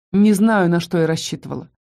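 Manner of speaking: ordinary delivery, nothing marked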